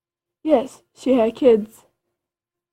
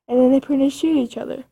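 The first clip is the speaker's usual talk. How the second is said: ordinary delivery, nothing marked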